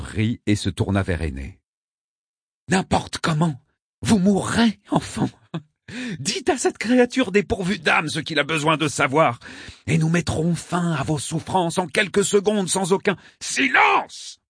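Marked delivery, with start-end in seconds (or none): 2.7-13.2 high-pitched, strained urgent appeal; desperate raised-voice plea. A narrator's reading. Mustapha urgently calls to the victim. 13.4-14.4 very high shouted order; explosive cry for silence; shouted command. A narrator's reading. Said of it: "cria Albedo".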